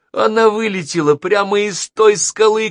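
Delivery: high, loud cry of alarm; cried out. A narrator's reading. Girl reporting the fighter to passengers, alarmed shout. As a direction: call it out urgently, high and loud. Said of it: "крикнула Долис".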